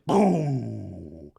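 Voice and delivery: low, long decaying boom; bomb sound. Retold or imitated. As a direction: drop into a deep movie-bomb boom. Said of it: "it's like that bomb sound".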